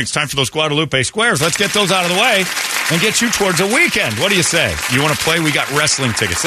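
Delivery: bright, high on-air host voice; radio announcer. Acted. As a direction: pump up the morning audience. Radio host launches contest segment.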